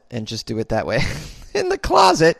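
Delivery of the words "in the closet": mock surprise. Mock-shocked echo of own plan.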